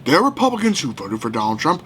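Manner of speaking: usual speaking voice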